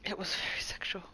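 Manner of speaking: mock-confidential whisper; hushed joke; joking whisper. Joking aside.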